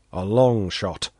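plain reading voice

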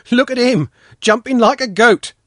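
put-on high, loud boyish jeer; scornful boy. A narrator's reading. Pashka watching Sanka race over ditches, loud scornful boy. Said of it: "Pashka muttered".

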